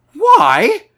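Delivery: high, loud startled outburst; sudden astonishment. A narrator's reading. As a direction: burst out in sudden surprise. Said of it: "said Huckster suddenly".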